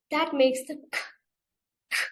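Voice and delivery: k as a short unvoiced puff from the back of the tongue; phonics k sound. Shown as a demonstration. Teacher modelling the k sound for the children. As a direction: pop out a quick k with air. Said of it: "push out air quickly".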